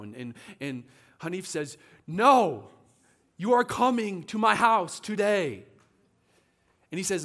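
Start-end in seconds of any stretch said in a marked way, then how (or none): 2.1-5.6 loud, raised-pitch mock order; booming, emphatic acted command; insistent demand. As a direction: act out a forceful refusal to take no.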